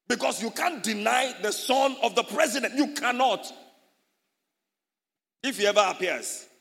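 loud, high, rousing sermon voice; full-force preaching. Acted. Pastor rouses the congregation about their father, loud preaching.

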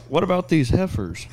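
lightly voiced stage comic; mock stand-up comic. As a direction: toss out a hammy joke setup. Acting out a stand-up comedy bit.

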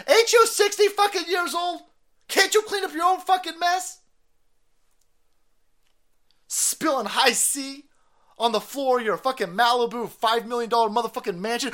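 high, loud exasperated rant; angry rant. Real, not acted. Host ranting at the grown son, high loud angry rant. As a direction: rip into him, pitch up.

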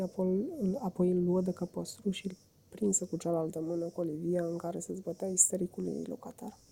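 ordinary delivery

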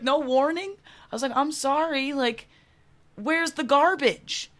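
high, loud pleading complaint; mock indignant protest. She begs cop for a warning, mock indignant plea. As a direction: plead your case, hammed-up and exasperated.

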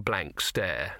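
ordinary delivery, nothing marked

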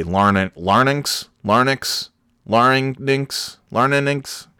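larynx said as "larnix", r before n; mispronounced larynx. Retold or imitated. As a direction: say larynx as "lar-nix", swapping the sounds. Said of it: "as most of my grade school teachers would say".

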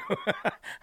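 helpless high-pitched laugh; high squealing laugh. Real, not acted. Cohost laughing at the host's rant, high and helpless. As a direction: burst into a shrieking giggle. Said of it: "Your face is getting red".

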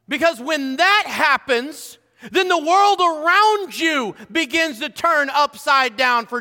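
loud, high, driving preacher's build; fiery preaching. Acted. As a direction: preach it hard to the congregation. Preacher rising again.

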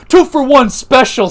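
high, loud cocky mock wrestler voice; imitating L A Knight. Imitating L A Knight after the second slap, high and loud. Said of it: "says L A Knight".

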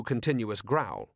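plain reading voice

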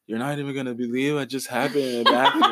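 put-on loose, slurred drunken words; lightly imitating a drunk friend; drunk slurring. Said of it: "slurring her words".

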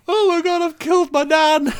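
high, loud horrified cry; panicked yell. Retold or imitated. Boy who thinks he killed his nan, panicked yell. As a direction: wail it at full volume, very high. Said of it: "I was just like".

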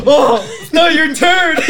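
loud, high, drawn-out objection; slow, high shout of playful outrage; shouted protest. Real, not acted. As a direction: cry foul at full voice, half laughing. Player protests out-of-turn move.

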